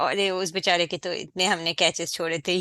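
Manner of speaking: a quick self-consoling excuse; fast Hindi excuse muttered to oneself; fast mock excuse. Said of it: "what I always recall is".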